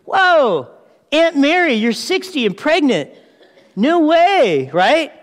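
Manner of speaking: stunned, teasing amazement; amazed. Acted. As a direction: act out disbelief at surprising family news. Acts Mary's amazement at the pregnancy.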